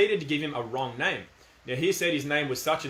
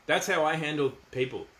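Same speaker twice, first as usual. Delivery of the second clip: ordinary talk, nothing marked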